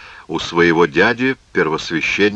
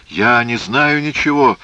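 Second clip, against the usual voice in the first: higher, pleading wail; plaintive moan; 6 semitones higher, 4 dB louder than usual. Acted. Hyrcanus pleads ignorance before his accusers, plaintive moan. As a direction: protest innocence in a pitiful raised voice. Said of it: "жалобно простонал Геркан".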